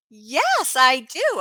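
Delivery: fake eager yes. Pretends to remember caller.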